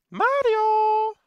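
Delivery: high cartoon shout of Mario; Luigi voice. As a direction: yell it like Luigi calling his brother. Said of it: "I'm Luigi".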